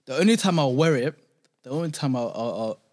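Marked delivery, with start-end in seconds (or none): none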